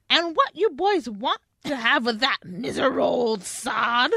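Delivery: a hint of an irritated Southern lady; angry Southern woman. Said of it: "You sound like, like, a angry Southern woman".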